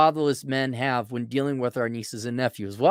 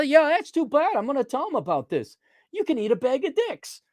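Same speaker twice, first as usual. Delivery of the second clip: high taunting little-brother voice; bratty sibling; 10 semitones higher than usual. Retold or imitated. Taunting his sister in a high snotty voice. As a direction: needle her like a snotty kid brother.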